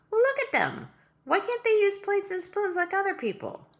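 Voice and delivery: put-on high, indignant girl's voice; a young girl scolding a tiny king. Girl scolding the king about his babies, high and indignant.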